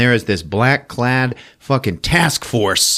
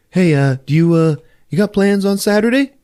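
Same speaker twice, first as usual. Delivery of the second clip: high eager guy; 11 semitones higher, 4 dB louder than usual. Retold or imitated. Neighbor pushing invite.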